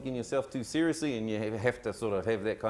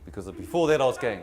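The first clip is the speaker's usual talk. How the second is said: normal talking voice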